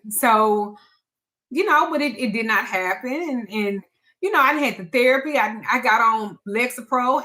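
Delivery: normal talking voice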